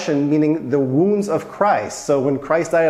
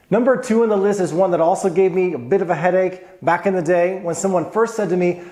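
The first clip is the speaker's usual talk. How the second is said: normal talking voice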